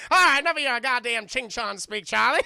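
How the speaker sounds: very loud mock reprimand; acted loud impatience; exasperated shout. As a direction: cut him off like a fed-up boss. Director cuts the cook off.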